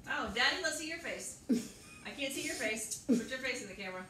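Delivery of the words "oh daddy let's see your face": ordinary talk, nothing marked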